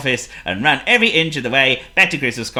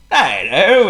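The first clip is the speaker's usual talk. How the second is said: chortling; 7 semitones higher, 7 dB louder than usual. A narrator's reading. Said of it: "he chortled".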